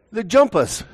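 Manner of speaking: high, non-rhotic Australian "They're jumpers." with jumpers said "jumpas"; mock Australian "They're jumpers." with the r dropped; Australian accent. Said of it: "I've been picking up some of the accent".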